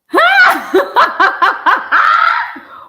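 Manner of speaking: loud, high burst of incredulous laughter; explosive laugh. Real, not acted. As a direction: cackle at full volume in disbelief. Reacting to the billing email.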